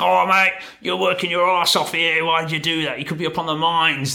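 high-pitched, hammed-up Aussie bloke; mock-Australian mate, incredulous and high; strong Aussie accent. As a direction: do your best broad Australian impression, pitched well up. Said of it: "my very best impression of a, a strong Aussie accent".

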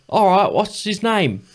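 high, slow Cockney impression. Metal singer asking a fan for a name, exaggerated Cockney. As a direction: mimic an off-stage singer in drawn-out Cockney. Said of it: "his literal voice is, he's just like".

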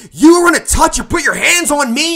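high, loud, indignant shout; angry yelling. Acted. Singer on the bar confronting the owner, angry yelling. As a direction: yell it down at him. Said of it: "ten feet tall yelling at him".

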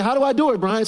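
put-on pleading young athlete; eager question. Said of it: "I said".

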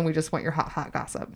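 whispered, secretive and teasing; whispering. Acted. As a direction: hush it like juicy gossip. Teasing hushed gossip request.

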